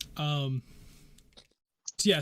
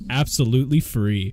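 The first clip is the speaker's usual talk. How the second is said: excited; 3 semitones lower, 12 dB louder than usual. Acted. NPC merchant pitch ends loud.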